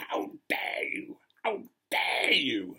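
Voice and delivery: slow, hammed-up fury with a harsh edge; incandescent rage. Churchgoer confronting the vicar, imitated as fuming. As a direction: act out an outraged accuser, drawing it out. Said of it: "absolutely fuming. She was incandescent with rage".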